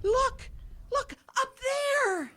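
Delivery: wife spotting Rudolph overhead, high excited shout. Acted. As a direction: shout it out as she spots him.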